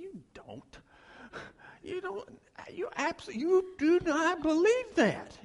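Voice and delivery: rising disbelief, you can't mean it; incredulous. Retold or imitated. Pastor's disbelieving inner thought.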